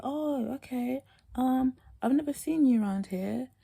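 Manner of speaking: light high polite chatter; high bright reply. Retold or imitated. Answering the boy, high and polite. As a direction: keep it high and chirpy.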